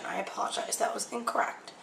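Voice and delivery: hushed aside, almost voiceless; quick whispered sorry; whispered apology. Real, not acted. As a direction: breathe the apology under your breath. Apologizing for a bad pour.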